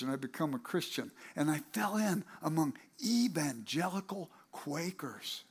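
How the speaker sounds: ordinary delivery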